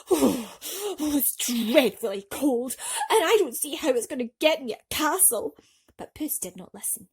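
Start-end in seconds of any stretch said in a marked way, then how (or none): 0.1-5.6 shiver out the words in icy water; shivering and grumbling. Acted. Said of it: "Grumbling and shivering".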